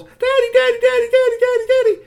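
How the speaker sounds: very high, loud squeals for Daddy; excited little girls. A narrator's reading. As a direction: shriek it over and over like thrilled kids. Little girls run out to father.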